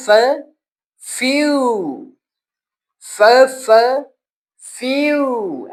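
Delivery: फ्यू slow, with a long "fyoo"; long u sound. Shown as a demonstration. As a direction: stretch the u in फ्यू slowly. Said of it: "यू की sound देगा और लंबा होगा".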